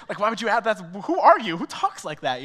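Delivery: high, loud mock disbelief; loud, high who-does-he-think-he-is scoff; incredulous scoff. Scoffing at friend's question.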